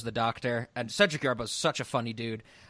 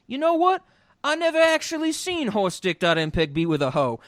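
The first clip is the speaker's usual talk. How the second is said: lightly voiced crony, pitched up; 9 semitones higher, 4 dB louder than usual. Host quoting a movie crony's confession, lightly put-on voice. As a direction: imitate one of the gang piping up, higher at the start. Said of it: "guys in his group are saying".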